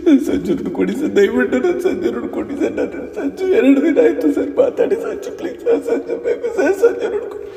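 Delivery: desperate sobbing plea, breaking into cries. Acted. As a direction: beg through tears for her lost son. Crying mother at station. (ಬಿಕ್ಕಿ ಬಿಕ್ಕಿ ಅಳುತ್ತಾ ಬೇಡಿಕೆ)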